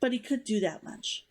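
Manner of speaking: steady, even novel reading; story narration. A narrator's reading. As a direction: read the chapter to listeners.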